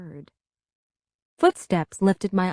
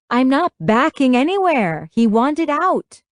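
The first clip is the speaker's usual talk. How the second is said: higher, louder angry protest; yelling; 7 semitones higher, 5 dB louder than usual. A narrator's reading. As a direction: raise your voice to defend yourself. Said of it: "why are you yelling?".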